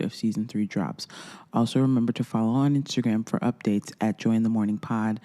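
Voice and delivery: podcast outro. Acted. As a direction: close the show like a host reading the outro.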